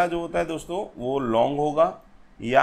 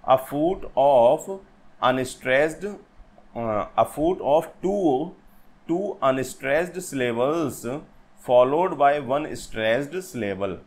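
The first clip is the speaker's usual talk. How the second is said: very slow, word-by-word definition; deliberate, drawn-out teacher's dictation; much slower than usual. Shown as a demonstration. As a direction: read it out slowly for note-taking. Repeats the definition for students.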